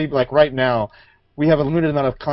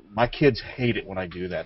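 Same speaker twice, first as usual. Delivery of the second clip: ordinary talk, nothing marked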